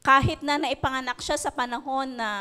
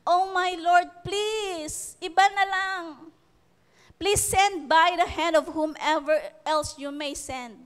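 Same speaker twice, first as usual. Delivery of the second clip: higher, begging Moses voice; mock pleading; 4 semitones higher, slower than usual. Moses begging the Lord to send someone else. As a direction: plead to be let off, voice lifted.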